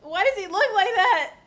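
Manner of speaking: high loud laughing question; flustered, laughing; giggly shriek. Real, not acted. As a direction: ask it half laughing.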